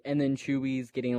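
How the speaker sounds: ordinary talk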